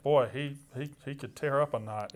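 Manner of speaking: normal talking voice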